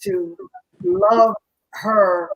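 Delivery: ordinary talk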